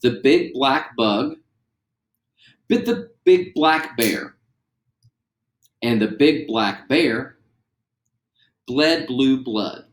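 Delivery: slow, every consonant spelled out; slow and deliberate. Shown as a demonstration. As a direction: spell out each word slowly. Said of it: "say 'em after me".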